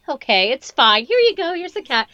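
high, sweet giving in. Retold or imitated. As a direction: yield gently to a child. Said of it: "the lady would be like".

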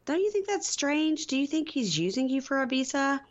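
lightly voiced nosy mother; mocking mom voice.

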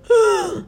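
a high startled gasp of panic; sharp gasp. Retold or imitated. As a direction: catch your breath in sudden shock. Panic on seeing the scene.

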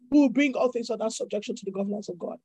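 quick restatement. Real, not acted. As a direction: rattle it off as a quick echo.

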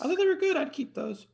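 suddenly very high-pitched, squeaky voice; loud start, voice shooting up an octave; voice gone high. Real, not acted. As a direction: let the voice jump way up high. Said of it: "Why'd your voice go so fucking high?".

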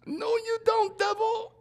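very high squealed rebuke; hammed-up high-pitched defiance; defiant rebuke. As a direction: squeal it at the devil. Acts out defying the devil.

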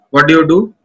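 normal talking voice

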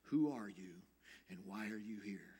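low, softened questions from the rabbi; quiet, low and searching; gentle. Retold or imitated. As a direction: ask it gently, dropping to a hush. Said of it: "very gently he said".

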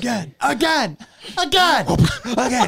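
high, laughing, insistent repeats; mock coach drilling. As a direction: bark it like a drill coach, cracking up.

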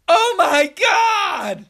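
very high, drawn-out shriek; imitated scream. Aunt finding her dog, loud high mock scream. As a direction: wail it out slowly at full volume.

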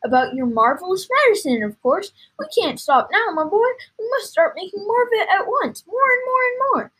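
high, loud, building enthusiasm; carried-away storybook dad; excited father. Acted. Excited father urging his son.

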